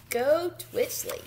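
ordinary delivery

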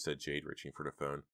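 usual speaking voice

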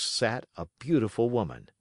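plain reading voice

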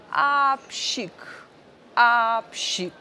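high, theatrical sneeze; wardrobe sneezing. Acted. Old wardrobe in the attic sneezes, hammed-up sneeze. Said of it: "kichnęła potężnie".

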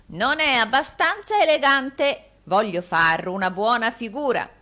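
loud, high roar easing off; roaring in her ear. A narrator's reading. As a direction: bellow it to a deaf servant. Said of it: "e le ruggì nell'orecchio".

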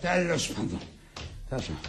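low, soft, trailing resignation; resigned muttering. Acted. Husband giving up on the quarrel, quietly resigned. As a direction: let the voice sink away.

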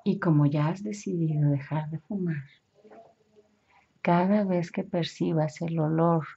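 calm, measured hypnotic suggestion; hypnosis guidance. Acted. Hypnotherapist guides imagined scene. (voz de hipnoterapeuta guiando)

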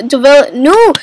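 usual speaking voice

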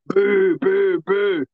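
loud, high mock booing; crowd booing. Fan imitating the crowd booing the heel. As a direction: boo like a jeering crowd.

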